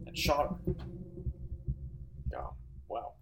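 rising, incredulous reread; stunned disbelief. Real, not acted. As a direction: say it again in disbelief. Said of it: "Holy fuck".

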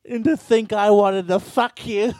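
hammed-up jilted girlfriend; mock scorned yell. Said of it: "And she's like".

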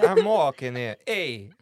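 loud hammed-up New York accent; playful, loud New York imitation; mock New York accent. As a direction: belt it like a New York street heckler. Echoes the New York riff.

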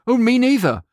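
high, loud, hasty agreeing; mock-eager yes-man; eager agreement. Acted clients hastily agreeing.

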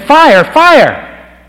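top-of-the-lungs shout, very high and loud; a man pounding harder to wake a sleeping family, yelling his loudest. Retold or imitated. All-out shouted warning. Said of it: "he pounded louder".

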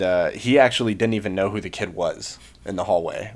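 ordinary delivery

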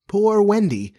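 high, mournful falling call; plaintive moan. A narrator's reading. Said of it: "it moans".

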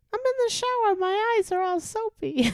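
a goofy high-pitched teacher joking down the phone; teacher's silly phone voice. Retold or imitated. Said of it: "He would also answer the phone and go".

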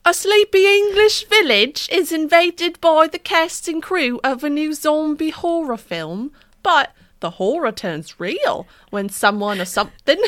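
hammed-up English accent slipping into Australian; high, theatrical would-be English accent, a little Australian; accidentally Australian English accent. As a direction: read it grandly in a shaky Australian-tinged British accent. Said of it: "It's a little Australian".

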